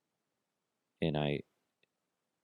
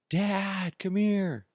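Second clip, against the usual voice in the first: high calling voice of a son; child calling; 13 semitones higher, much slower than usual. Retold or imitated. Son calling his dad at night, a high call.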